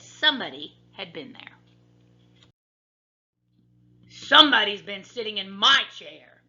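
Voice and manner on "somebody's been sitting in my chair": loud, great big bear voice; father bear growl. A narrator's reading. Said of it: "growled the father bear in his great big voice".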